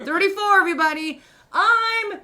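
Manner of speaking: high, loud, drawn-out scream of her age; screaming out the window. Pretend. Host proudly screaming her age out the window to everyone. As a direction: yell it to the whole street. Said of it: "I just screamed it out the window".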